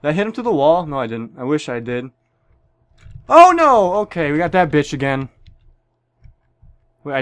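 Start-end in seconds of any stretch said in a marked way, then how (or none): 3.3-3.9 alarmed cry. Real, not acted. Sudden danger in game.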